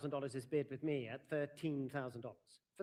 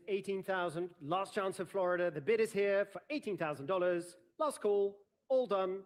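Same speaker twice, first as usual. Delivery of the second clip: loud; 4 semitones higher, 6 dB louder than usual. Real, not acted. Closing call before hammer.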